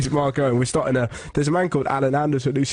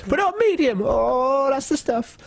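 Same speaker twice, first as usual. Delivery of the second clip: much higher, tipsy woman; drunk mum voice; 14 semitones higher, slower than usual. Retold or imitated. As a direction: squeal it like a merry drunk mum.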